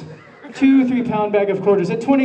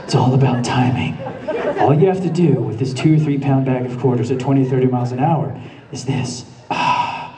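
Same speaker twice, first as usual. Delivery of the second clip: calm; 6 semitones lower than usual. Retold or imitated. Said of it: "I'm a martial artist".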